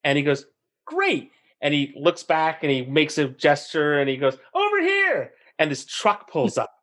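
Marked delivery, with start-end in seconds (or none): 0.8-1.3 much higher, quick upbeat "Great."; cheery great. Retold or imitated. Retold cyclist answers. 4.5-5.3 much higher call across the desert. Retold or imitated. Cyclist calls the truck over.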